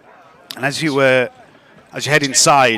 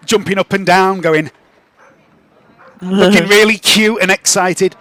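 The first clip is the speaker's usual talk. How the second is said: ordinary delivery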